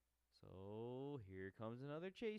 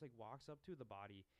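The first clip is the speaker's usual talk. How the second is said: ordinary delivery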